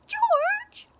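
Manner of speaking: high shrieking yell. A narrator's reading. Grandma screaming for George from the roof. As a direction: screech his name. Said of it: "Grandma yelled".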